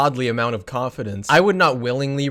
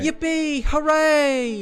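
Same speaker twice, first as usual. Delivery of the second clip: put-on high, gleeful little kid; child cheering; 13 semitones higher, much slower than usual.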